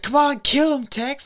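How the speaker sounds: very high-pitched egging-on; mock urging. Girl behind urging Tex to kill, high mock urging. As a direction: imitate a girl goading her partner.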